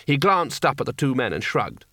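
plain reading voice

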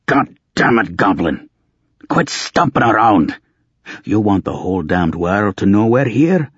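loud, high angry bark. Acted. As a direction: snap it out angrily. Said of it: "One Eye barked".

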